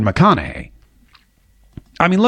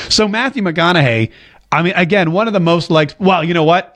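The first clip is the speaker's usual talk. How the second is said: ordinary talk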